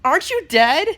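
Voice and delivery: put-on high, loud child voice; puzzled little kid. Kid challenging Spider-Man, imitated puzzled child. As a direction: blurt it like a kid catching someone out. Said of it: "the third kid to my left was like".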